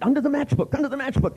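rising high, urgent repeats; hammed-up frantic pointing; mock alarm. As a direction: pretend panic to play along. Playing along with paranoia.

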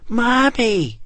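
put-on high little girl's cry; shrill child cry. A narrator's reading. Little girl shrilling for her mother, high cry. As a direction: shriek for her mommy. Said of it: "Winnie shrilled".

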